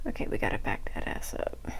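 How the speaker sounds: low, hushed, creaky mutter; muttered aside. Real, not acted. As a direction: grumble it quietly to yourself on catching a slip. Catching own mistake mid-count.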